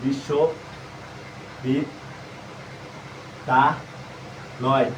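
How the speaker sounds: বিশ্ববিদ্যালয় stretched very slowly, breathy and low, as if typing it; slow spelling-out. Shown as a demonstration. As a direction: drag বিশ্ববিদ্যালয় out syllable by syllable under the breath. Typing the word out letter by letter.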